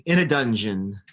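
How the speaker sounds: read-aloud narrator building suspense; dramatic storybook narration. A narrator's reading. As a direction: boom out the first word, then ease into a slow storytelling pace. Reading picture book aloud.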